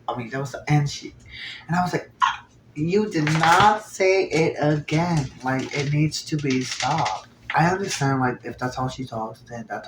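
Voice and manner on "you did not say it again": slow, drawn-out can't-believe-it; disbelief. Retold or imitated. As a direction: spell out each word in disbelief. Shocked reaction to repeated slur.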